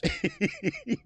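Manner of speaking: sharp high-pitched laugh. Real, not acted. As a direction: burst into a squeaky laugh. Laughing at Rolly short bus.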